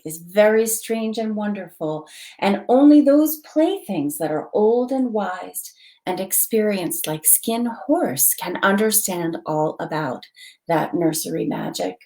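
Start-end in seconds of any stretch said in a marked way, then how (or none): none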